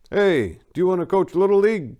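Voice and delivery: groundskeeper voice. Acted. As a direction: voice the cheerful groundskeeper.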